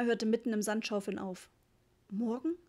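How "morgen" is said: mildly startled, voice lifting at the end; a touch of alarm in the question. A narrator's reading. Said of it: "sagte sie erschrocken". (leicht erschrocken nachgefragt)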